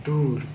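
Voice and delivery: दूर said softly with weight on the long दू; stressed demo. Shown as a demonstration. Teacher modelling दूर for pupils. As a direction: press दू in दूर. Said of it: "एक उच्चारण मैं करने जा रहा हूँ".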